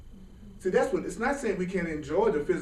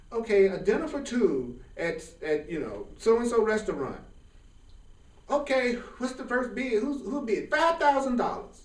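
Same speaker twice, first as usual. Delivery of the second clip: higher, lively auction call; auctioneer; 6 semitones higher than usual. Retold or imitated. Telling the audience about the charity auction, a light auctioneer voice. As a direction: rattle off the bids like an auctioneer. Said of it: "people were like going".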